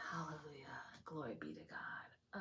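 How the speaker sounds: very soft, low, breath-light murmur; quiet worship after an outburst; hushed praise. Real, not acted. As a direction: drop to a reverent near-whisper. Winding down after ecstatic praise.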